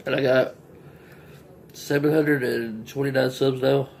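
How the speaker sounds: talking between bites; mouth full, chewing. Real, not acted. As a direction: food in cheek. Seen 1: Just bit sandwich; cheeks full, jaw working while talking.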